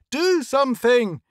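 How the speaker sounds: high, loud scream. A narrator's reading. Lois screaming at his staff over traffic, high and loud. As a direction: scream for help in desperation. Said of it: "he screamed".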